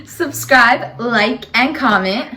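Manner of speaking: shouted, stretched subscribe call; loud sign-off. Real, not acted. Vlogger to viewers, loud slow call. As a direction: belt out the outro.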